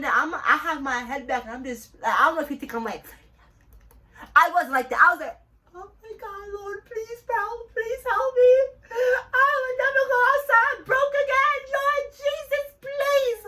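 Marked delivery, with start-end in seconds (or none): 5.7-13.4 high, loud wailing plea; mock desperate prayer. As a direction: beg heaven in a hammed-up tearful squeal. Retold desperate prayer for help.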